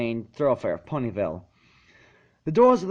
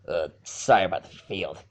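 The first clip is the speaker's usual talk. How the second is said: wincing apology; 7 semitones lower, 3 dB quieter than usual. A narrator's reading. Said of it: "He winced".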